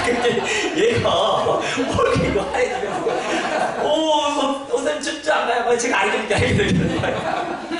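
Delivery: high, slow, laughing singsong. Real, not acted. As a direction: tell it through laughter in a singsong.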